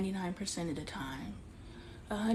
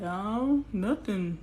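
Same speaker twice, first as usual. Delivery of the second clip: retold evasiveness; 4 dB louder, much slower than usual. Imitates his evasive answer on phone.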